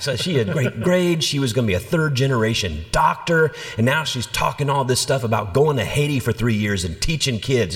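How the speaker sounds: imitated indignant complaint, high-pitched; mad father. Father complaining about his daughter going to Haiti, mock indignant. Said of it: "He was mad, so mad".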